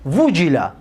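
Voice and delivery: وجیله said "wujila", with "u" on the first letter; passive-voice form. Shown as a demonstration. Teacher answers his own question, وجیله pronounced "wujila".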